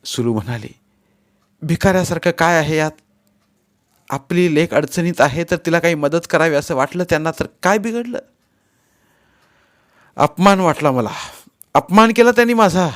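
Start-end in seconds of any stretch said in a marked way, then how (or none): 1.6-8.2 higher-pitched exasperated reasoning; protesting. A narrator's reading. Wife defending her parents to angry husband, protesting. 10.2-12.9 loud, rising indignation; angry outburst. A narrator's reading. Husband feeling insulted by in-laws, angry outburst. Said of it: "मी रागानं तडतडलोच".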